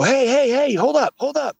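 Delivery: high-pitched mock hailing; retold calling out. Retells flagging down Joe's car.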